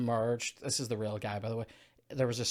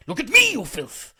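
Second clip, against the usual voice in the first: high, loud villain outburst; hammed-up scolding at full volume; mock furious shout; 12 semitones higher, 12 dB louder than usual. Acting out Cal's angry film line.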